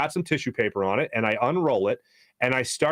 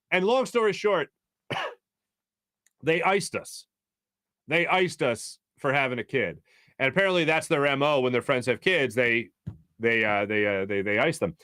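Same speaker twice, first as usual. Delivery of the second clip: normal talking voice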